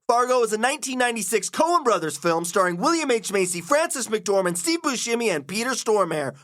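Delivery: high-pitched podcast host intro; show intro announcer. Acted. Host introducing the film and guests to listeners, announcer voice.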